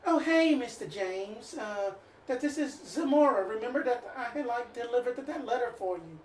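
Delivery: put-on a light female Southern accent; sweet Southern greeting, lightly done. NPC Zamora greets Mister James in a Southern accent. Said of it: "Female Southern accent".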